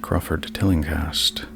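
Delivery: ordinary delivery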